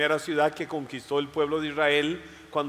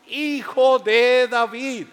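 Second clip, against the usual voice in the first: higher, loud, drawn-out call; crying out; 7 semitones higher, 7 dB louder, much slower than usual. Acted. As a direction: stretch the cry out slowly. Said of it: "Ese fue el grito de Bartimeo".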